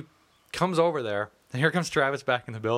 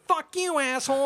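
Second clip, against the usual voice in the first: very high, hilarious squealed insult; mock high-pitched yell; 13 semitones higher than usual. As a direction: imitate a furious man's voice shooting way up. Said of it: "it got, like, high as shit".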